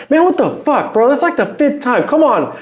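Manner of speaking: high mock yelling engineer; imitated cussing coach. Engineer cussing at the rapper in the booth, high mock yell. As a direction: ham up his impatient yell. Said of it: "He started cussing at me".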